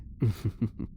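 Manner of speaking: a quiet, breathy little chuckle; soft laugh. Acted. Elf prisoner chuckling softly at his guard.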